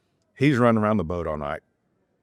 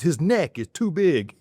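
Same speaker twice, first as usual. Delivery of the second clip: imitating cousin; 9 semitones higher, slower than usual. Cousin voiced in boat.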